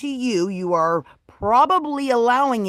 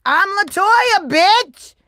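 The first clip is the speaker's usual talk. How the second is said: sassy; 8 semitones higher, 6 dB louder, much slower than usual. Pretend. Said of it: "And be like".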